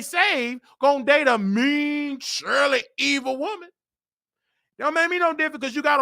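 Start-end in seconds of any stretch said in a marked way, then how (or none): none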